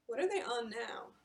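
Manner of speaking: usual speaking voice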